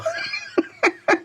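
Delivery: breathy high laugh bursting out; high wheezing laugh. Real, not acted. Laughing at his own joke about the fart thumbnail.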